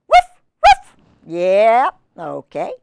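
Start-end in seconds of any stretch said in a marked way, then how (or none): 0.1-0.9 high, loud double woof; puppy woof. Acted. Biscuit answering the reader, high loud puppy woofs. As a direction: yip like an eager little puppy. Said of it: "And Biscuit says".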